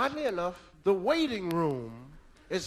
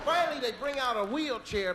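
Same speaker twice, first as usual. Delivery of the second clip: ordinary delivery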